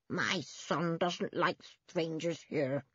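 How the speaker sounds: put-on slow, mumbled old-woman voice; mumbling. A narrator's reading. Old woman warning off the children, mumbling. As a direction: mumble slowly, words barely understandable. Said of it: "mumbling so much that the children could hardly understand".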